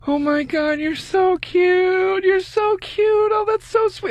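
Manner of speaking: very high, drawn-out mock cooing; imitated gushing woman. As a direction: gush in an exaggerated falsetto, stretching the words. Imitating friend gushing over him.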